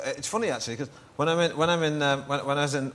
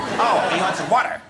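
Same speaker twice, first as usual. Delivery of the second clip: put-on high, loud American accent of sudden understanding; 8 semitones higher, 8 dB louder than usual. American finally understanding the Englishman's order, exaggerated American accent. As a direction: exclaim it as a mock American who finally gets it. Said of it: "And they go".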